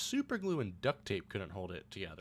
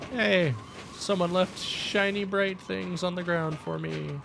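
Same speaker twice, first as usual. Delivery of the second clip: mock cheerful; 7 semitones higher, 7 dB louder, slower than usual. Real, not acted. Mock praise of flares.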